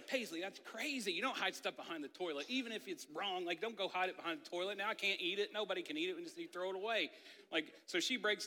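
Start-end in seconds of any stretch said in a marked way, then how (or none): 0.1-7.1 high-pitched, exasperated dad rant; exasperated scolding. Retold or imitated. Father reacting to daughter's hiding place, exasperated scolding. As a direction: scold in rising disbelief, without shouting.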